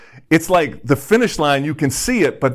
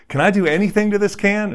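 a high, fast mock yell from the back; retold yell; 8 semitones higher, faster than usual. Youth calling out to the pastor mid-sermon, mock yell. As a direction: call out like an eager teenager. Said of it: "the youth just yells out".

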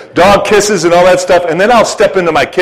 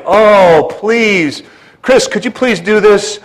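higher, imploring mock request; imitating a meek plea; mock pleading; 6 semitones higher, slower than usual. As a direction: beg politely, hammed-up. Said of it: "Paul isn't like".

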